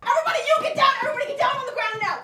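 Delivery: loud, high, rapid commands; hostage-taker's shout. Pretend. Robber ordering a bank to get down, shouted. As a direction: take control of the room at full volume.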